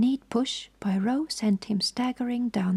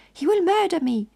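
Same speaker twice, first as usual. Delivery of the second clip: high, loud panicked cry; terrified shriek; 9 semitones higher, 4 dB louder than usual. A narrator's reading. The woman shrieks about the Italian, terrified. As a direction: scream it in terror. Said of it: "she shrieked".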